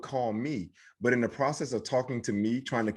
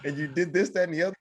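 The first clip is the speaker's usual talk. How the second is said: lightly voiced stranger, higher pitched; imitated chatty stranger; 7 semitones higher, faster than usual. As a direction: recite it like a chummy caller, pitched up. Voices strangers reciting his life.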